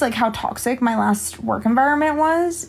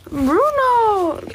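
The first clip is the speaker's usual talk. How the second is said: calling the dog, much higher and insistent; 11 semitones higher than usual. Real, not acted. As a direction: call his name up high, sing-song.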